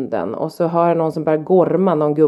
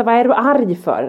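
higher-pitched acted challenge; confrontational retort; 6 semitones higher than usual. Mum confronts the angry dog owner, confrontational retort. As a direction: re-enact an indignant comeback.

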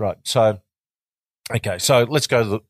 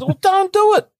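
high mocking voice; 5 dB louder than usual. Quoting advice in put-on voice.

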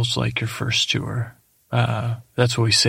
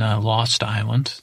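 usual speaking voice

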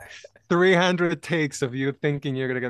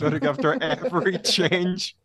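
laughing; 5 semitones higher, 4 dB louder than usual. Real, not acted. Mocking own on-set behavior, laughing.

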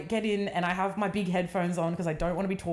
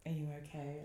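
soft concern; 5 semitones lower, 12 dB quieter, faster than usual. Retold or imitated. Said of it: "she's like".

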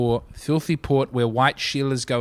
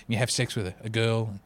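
lower, softer imitation of the wrestler's drawling accent; imitating Jake the Snake; 9 semitones lower, 7 dB quieter than usual. Quoting the wrestler's documentary confession in a low, soft imitation of his accent. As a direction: growl it low and quiet like a worn-out wrestler. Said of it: "I don't think he had that accent".

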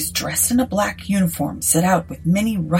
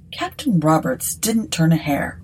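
plain reading voice

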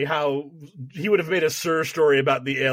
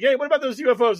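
higher, louder eager question; eager mock Carter; 8 semitones higher, 4 dB louder, faster than usual. Carter asking agents about UFOs, eager. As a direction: ask it all keen and naive. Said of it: "he's like".

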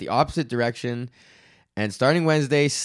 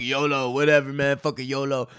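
mock carefree dude voice, a bit louder; careless yolo dude; 4 semitones higher, 3 dB louder than usual. Mocking a reckless guy who excuses being an asshole, careless mock voice. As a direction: toss it off like a reckless bro. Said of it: "And just be like".

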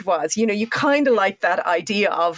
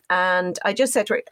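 ordinary talk, nothing marked

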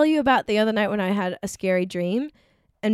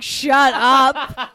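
laughing; 5 semitones higher, 7 dB louder than usual. Retold or imitated. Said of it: "He was like".

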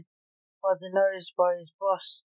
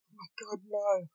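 soft, stricken disbelief; understated grief, starting very quietly; hushed, mildly tearful shock; weeping; 3 dB quieter than usual. A narrator's reading. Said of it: "weeping tears of blue electric".

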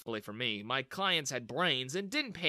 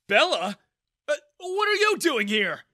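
high, loud startled question; surprised; 13 semitones higher, 10 dB louder than usual. A narrator's reading. Father startled to see his daughter arrive, surprised. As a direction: caught off guard, blurt it. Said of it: "Daniel asked in surprise".

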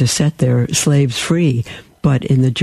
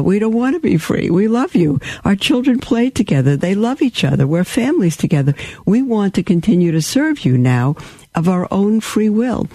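voiced slaves pleading; 7 semitones higher than usual. Slaves speaking to master family.

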